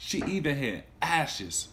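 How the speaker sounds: gentle read-aloud storytelling; storybook narrator voice; story narration. A narrator's reading. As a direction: read it to a class of children. Teacher reads story aloud.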